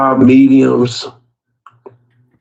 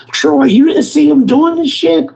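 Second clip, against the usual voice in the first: squeaky chef voice; 13 semitones higher than usual. Retold or imitated. Upset chef voiced in story.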